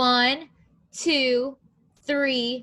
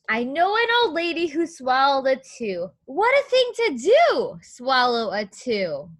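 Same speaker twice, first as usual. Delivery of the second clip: lilting, louder read-aloud rhyme; storybook reading; 2 semitones higher, 5 dB louder than usual. Acted. Teacher reading the rhyme to young children, sing-song. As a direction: read the rhyme like a picture book to kids.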